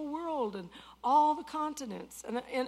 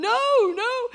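high-pitched crying protest, loud; loud high tearful plea to the Lord; high loud tearful pleading; 12 semitones higher, 11 dB louder than usual. Retold or imitated. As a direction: beg through tears, high and loud. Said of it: "I am crying".

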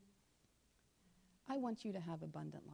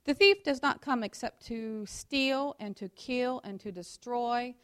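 loud, slow, deliberate scripture read; 2 semitones higher, 9 dB louder, slower than usual. Acted. Preacher reading Ephesians to the congregation, slow and loud. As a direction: proclaim each phrase to the room.

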